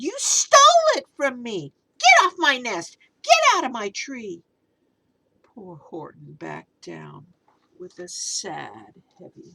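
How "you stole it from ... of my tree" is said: loud, high angry bird; angry demand. Acted. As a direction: order him off the nest. Maisie demanding her egg back.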